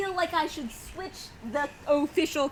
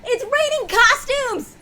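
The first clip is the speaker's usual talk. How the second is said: excited; 8 semitones higher, 6 dB louder than usual. Real, not acted. Excited gift reveal exclamation.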